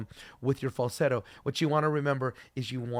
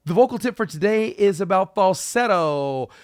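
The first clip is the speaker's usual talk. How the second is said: loud announcement; 5 semitones higher, 9 dB louder than usual. Acted.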